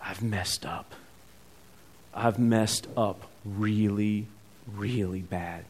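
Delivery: soft, slow, broken admission; hushed regret, as if to himself; quiet remorse. Acted. Son realizing his failure.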